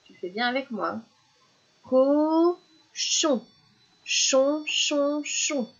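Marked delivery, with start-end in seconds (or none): 1.8-5.7 high, split "co, chon", then "chon" three times; sing-out "Cochon" in syllables, higher pitch; syllable drill. Shown as a demonstration. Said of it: "On le refait une fois".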